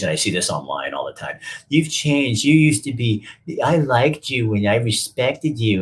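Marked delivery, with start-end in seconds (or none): 1.7-5.8 high, whiny sneer, slower at the end; mocking critics. Host mocking online critics in a whiny voice. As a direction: mimic a disappointed fan with scorn. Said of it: "and all this bullshit".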